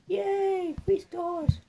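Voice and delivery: high, happy squeal; excited cheer. Acted. Celebrating a won level.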